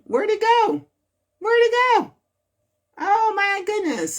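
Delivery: high, squeaky little fluster; high-pitched dismay. Real, not acted. Host hunting for a lost item, high-pitched dismay. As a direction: fret in a high voice over something mislaid. Loses place, searching for it.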